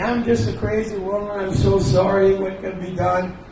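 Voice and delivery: high, pleading, half-hysterical; frantic apology. Retold or imitated. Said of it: "crying and screaming".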